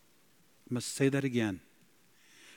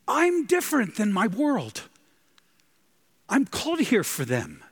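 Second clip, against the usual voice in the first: higher and louder, full-force preaching; impassioned preaching; 9 semitones higher, 6 dB louder than usual. Acted. Preacher proclaims to the congregation, impassioned and loud. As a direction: cry it out in awe.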